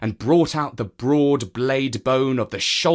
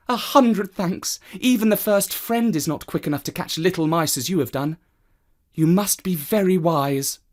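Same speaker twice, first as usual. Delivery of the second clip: grateful woman; 7 semitones higher than usual. Acted. Woman thanking the cat for catching the mouse, higher.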